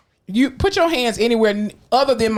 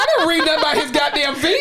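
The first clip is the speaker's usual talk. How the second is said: laughing; 7 semitones higher than usual. Real, not acted. Quoting herself, laughing.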